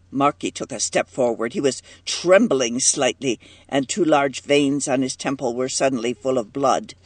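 usual speaking voice

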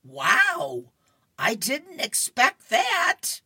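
loud, high, drawn-out surprise; hammed-up comic shock, stretched out; acted astonishment. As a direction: blurt it high and slow, taken aback. Voicing Opal's shock at friend.

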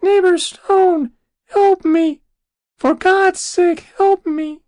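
very high, loud, squeaked plea; small piping voice. Acted. Trapped soul begging neighbor for help, piping squeak. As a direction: plead like a tiny trapped creature. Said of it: "small sort of piping voice".

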